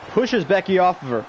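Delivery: ordinary talk, nothing marked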